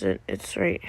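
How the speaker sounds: fast whisper to herself. Real, not acted. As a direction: whisper while hunting for it. Sudden whispering while searching.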